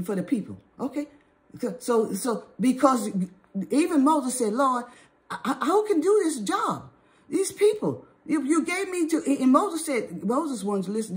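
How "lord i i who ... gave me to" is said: high-pitched, stumbling protest to God; overwhelmed plea. Acted. Moses complaining to the Lord about the people, overwhelmed plea. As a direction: plead upward as one overburdened by a task.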